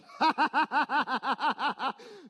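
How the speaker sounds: long, loud burst of rhythmic laughter; real belly laugh in rapid pulses. Real, not acted. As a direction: crack up in a run of ha-ha-has. Laughs at own perfect English claim.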